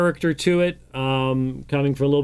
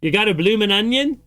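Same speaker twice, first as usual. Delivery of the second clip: higher, louder mock Australian accent; bad Australian accent; 7 semitones higher, 4 dB louder than usual. Host repeats his joke for the caller, in a bad Australian accent. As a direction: say it again in a hammed-up Australian accent. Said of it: "bad Australian accent".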